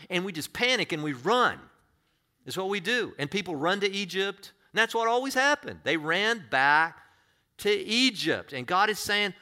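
loud, high preaching build-up; fiery sermon climax; preaching at full force. Acted. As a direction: preach it out, rising to a cry.

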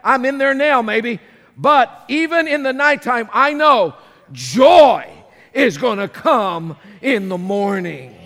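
loud, rising preacher's cry; preaching at full force. Acted. Preaching at full force, David's words.

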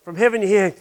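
ordinary delivery

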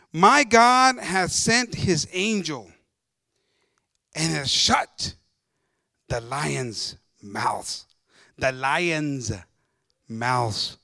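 slow, weighty declamation; preacher's scripture reading. A narrator's reading. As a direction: start loud and drop low, drawing out each phrase. Reads scripture aloud to congregation.

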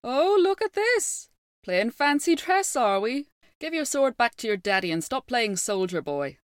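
high mocking jeer. A narrator's reading. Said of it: "they began to laugh and jeer".

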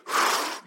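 short, soft puff of air; blown breath. Acted. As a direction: breathe out audibly, as if giving breath. Acts out God giving breath.

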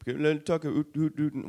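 high, loud mock Canadian. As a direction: stretch the Canadian "oot" sounds. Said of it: "the stereotypical Canadian accent".

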